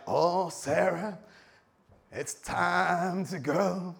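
very slow, stretched Texan drawl; hammed-up Texan, drawing out every word; Texan accent. Abraham from Texas calling his wife to go, Texan drawl. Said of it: "he's from Texas".

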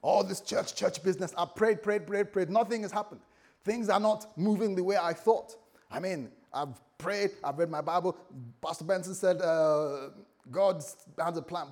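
loud, high, exasperated rant; venting frustration. Acted. Frustrated believer complaining that prayer changed nothing, venting. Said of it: "vent their frustrations on".

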